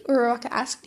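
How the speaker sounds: ordinary delivery, nothing marked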